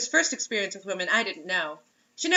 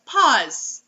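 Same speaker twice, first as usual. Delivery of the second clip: firm stop; 7 dB louder than usual. Real, not acted. Stops the reading.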